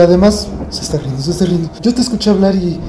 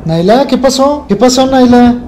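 higher, louder worried call; 6 semitones higher, 5 dB louder than usual. Real, not acted. Owner calling his barking dog, high and worried.

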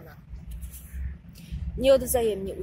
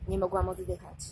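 usual speaking voice

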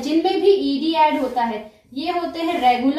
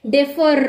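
Differ with a drawn-out final र; differ, final r held and stressed; stress on last syllable; 3 dB louder, much slower than usual. Shown as a demonstration. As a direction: lean on the र that ends differ. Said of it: "र पे sound आ रहा है".

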